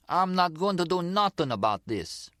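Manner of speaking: woman's mock Italian-accented tough guy, pitched high; imitated Zip's accent. Gloria retelling the Zip's words to Nicky, mimicking his accent. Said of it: "giving her version of the Zip's accent".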